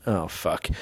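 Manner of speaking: quiet, low dismayed swear; muttered dismay. Retold or imitated. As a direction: curse under your breath. Dismayed reaction.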